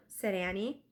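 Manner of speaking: ordinary delivery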